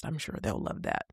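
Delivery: dry sarcastic aside, half whispered. Real, not acted. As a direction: throw it away sarcastically, under your breath. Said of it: "Laughs and sarcasm".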